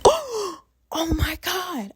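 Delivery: sudden gasp, then a high, shaken oh my God; real shock from a bump to the head; startled gasp. Real, not acted. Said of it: "I just hit my forehead on my phone".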